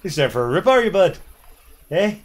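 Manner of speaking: hammed-up Canadian accent. Showing off to co-player, mock Canadian accent. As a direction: show off a jokey Canadian accent. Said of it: "You like my Canadian accent there".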